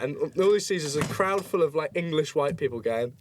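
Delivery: usual speaking voice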